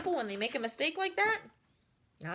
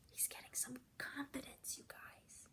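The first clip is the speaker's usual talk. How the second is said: whisper; 2 semitones higher, 19 dB quieter than usual. Real, not acted. Teacher whispering to the kids that Wadney grows bolder. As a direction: lean in and whisper the news. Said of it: "whispered aside to listeners".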